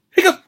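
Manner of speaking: sudden high squeaky hiccup. Pretend. Acts hippo hiccup.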